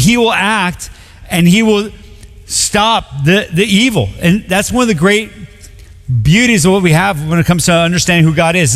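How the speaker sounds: ordinary talk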